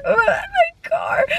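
high, drawn-out mock sob; freaking-out wail. Driver stuck in a muddy field, freaking-out wail. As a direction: wail it very slowly, squeaky with panic. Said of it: "I'm, like, freaking out".

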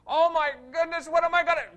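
high, loud mock panic; panicked fretting. Mimicking a fretful believer, high and loud panic.

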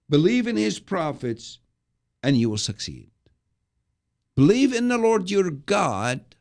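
rally the people, voice lifted; preaching the king's call. Acted. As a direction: Jehoshaphat rousing his people, fervent. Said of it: "Jehoshaphat stopped and said".

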